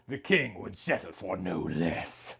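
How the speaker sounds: ordinary delivery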